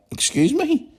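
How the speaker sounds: high incredulous excuse me, then tart reply; retold indignant comeback. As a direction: put her in her place, affronted. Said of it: "I just looked at her and went".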